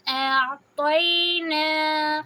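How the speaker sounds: slow chanted recitation with lengthened vowels; Quran recitation. Shown as a demonstration. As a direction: recite it slowly, holding each vowel two counts. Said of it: "دو حرکہ آواز کو لمبی کریں گے". (تلاوت کے انداز میں آہستہ، لمبی آواز)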